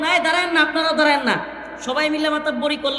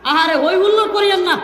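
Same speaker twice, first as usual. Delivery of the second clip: louder, higher rebuke; exasperated scolding; 4 semitones higher, 6 dB louder than usual. Real, not acted. Preacher scolds the rowdy crowd, loud and exasperated. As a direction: scold them to stop the racket.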